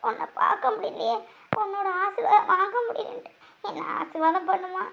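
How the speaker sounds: soft tearful plea. Acted. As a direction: plead gently, almost whispered. Daughter pleads softly, tearful. (மெதுவாக கண்ணீருடன் கெஞ்சுதல்)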